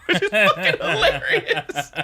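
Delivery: laughing it out, slowly and high; real laughter breaking through the words. Real, not acted. Laughing at the joke.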